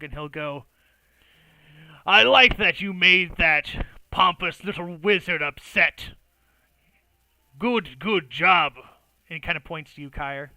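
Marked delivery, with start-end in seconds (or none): 2.0-8.7 loud approving grunt of praise; gruff orc praise. Acted. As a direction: bark out hearty praise.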